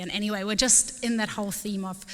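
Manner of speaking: ordinary talk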